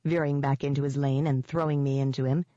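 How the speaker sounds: ordinary delivery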